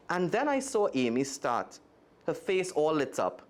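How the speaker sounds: expressive read-aloud storytelling; lively storybook reading voice; story narration. A narrator's reading. Reads a children's story aloud.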